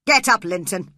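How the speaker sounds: loud barked order; angry shout. A narrator's reading. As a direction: shout at the boy to get up. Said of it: "He shouted angrily to his son".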